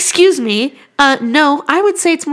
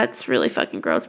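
much lower, rough croak; hoarse sick voice; 9 dB quieter than usual. Shown as a demonstration. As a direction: push the cold into the throat. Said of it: "as you can tell by my gross voice".

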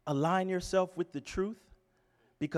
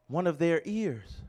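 usual speaking voice

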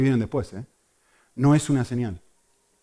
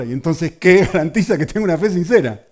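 loud, eager question from the crowd; a mock voice of the curious congregation; imitating the congregation; 5 semitones higher, 9 dB louder than usual. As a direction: voice the whole church asking at once. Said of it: "todo el mundo está preguntando".

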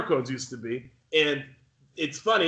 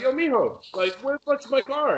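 higher, indignant demand at the valet; angry confrontation; 8 semitones higher than usual. Retold or imitated.

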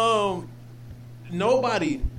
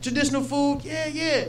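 higher-pitched, casual teasing questions; retold fake small talk, lightly pitched up; mock-innocent baiting; 6 semitones higher than usual. Storyteller baiting his coworker about Mexico, mock-innocent.

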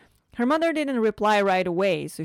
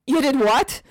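disbelief; 7 semitones higher, 8 dB louder than usual. Acted. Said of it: "eyes widened in disbelief".

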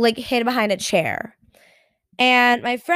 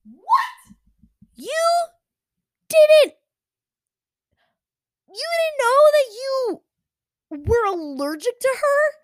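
shocked disbelief; 11 semitones higher than usual. Real, not acted. Hearing friend wants to return the dog, stunned and incredulous. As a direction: sputter in disbelief.

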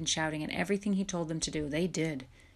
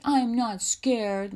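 loud, higher, drawn-out protest; indignant denial; 5 semitones higher, 7 dB louder, much slower than usual. A narrator's reading. Louis answers the teasing, indignant. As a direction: stretch out your objection.

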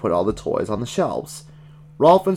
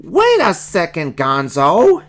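high character voice calling a halt; raised cartoon yell of protest; 9 semitones higher, 5 dB louder, slower than usual. A narrator's reading. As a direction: yell it as an indignant little bear. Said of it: "yelled Fozzie".

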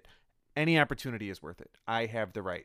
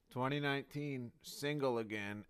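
ordinary talk, nothing marked

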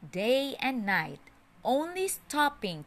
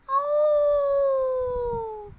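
much higher, long held "Oh"; big bad wolf's call; 4 dB louder than usual. A narrator's reading. Wolf calling at the straw house door, long high "Oh". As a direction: stretch the Oh like a sly wolf calling. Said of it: "cried the wolf".

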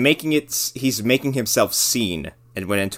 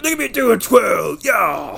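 much higher, louder show-off; hammy hero voice; 10 semitones higher, 5 dB louder than usual. Retold or imitated. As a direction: preen like a vain hero. Mocking naked Beowulf twirling.

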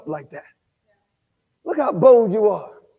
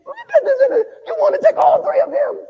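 much higher, amused marvel; high mocking exclaim. Preacher teasing a drunk clubgoer, high amused exclaim.

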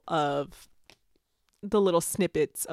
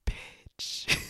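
breathy unvoiced aside; hushed stunned reaction; whispered bitch; 5 dB quieter than usual. Real, not acted. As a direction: whisper it in awe. Reacting to the spicy passage.

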